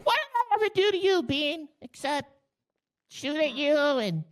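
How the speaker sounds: ordinary delivery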